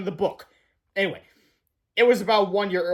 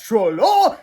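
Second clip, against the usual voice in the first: loud high-pitched superhero cry; heroic yell; 4 semitones higher, 7 dB louder than usual. Acted. Reader shouting the hero's cry. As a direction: belt it out like a cartoon hero.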